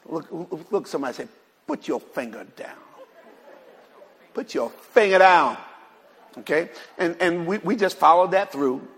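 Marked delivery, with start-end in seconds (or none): 1.6-2.8 low, hushed aside with a slight laugh; soft instruction. Shown as a demonstration. Preacher cues the congregation to tell someone, softly amused. 4.3-5.6 emphatic command. Shown as a demonstration. Repeats the cue, much louder.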